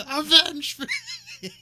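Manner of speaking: dying dad's hammy shout, high and loud; overacted death scene. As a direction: bellow it out with a laugh, way over the top. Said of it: "he just kinda spouts up with energy".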